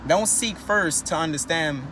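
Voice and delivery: normal talking voice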